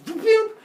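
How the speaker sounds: high, loud "whoop"; whooping effect noise. Retold or imitated. Epimetheus grabbing dagger teeth, whooping.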